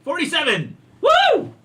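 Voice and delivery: loud, high whoop of triumph; excited cheer. Real, not acted. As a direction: cheer the lucky roll. Cheering a good dice roll.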